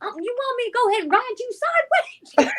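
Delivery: high, rushed mock threat; hammed-up comic challenge; playful goading. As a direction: tease her in a quick high-pitched voice. Acts out goading friend onto panel.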